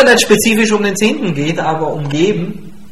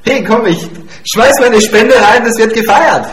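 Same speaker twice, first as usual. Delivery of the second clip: higher, louder festive cheer; rallying everyone to celebrate; celebratory; 4 semitones higher, 5 dB louder than usual. Acted. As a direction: act out an eager giver joining a party. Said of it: "erstmal ordentlich gefeiert haben".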